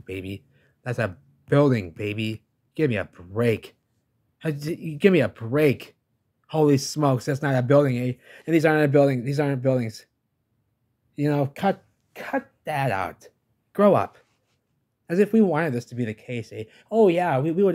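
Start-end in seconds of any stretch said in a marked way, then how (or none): 0.8-2.4 louder, emphatic repeat of That's a building; louder insistence. Real, not acted. 2.8-14.2 loud, higher, fed-up outburst; exasperated rant. Real, not acted.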